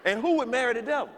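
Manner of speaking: mock disbelief. Real, not acted. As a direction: act scandalized at the idea. Comic disbelief at devil's marriage.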